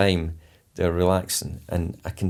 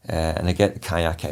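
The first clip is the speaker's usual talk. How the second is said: ordinary talk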